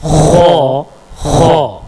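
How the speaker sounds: खो with a rasping kh from the upper throat; letter demo. Shown as a demonstration. Teacher showing students the letter, rasping throat sound. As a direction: scrape खो out from the back of the throat. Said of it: "खो निकलेगा".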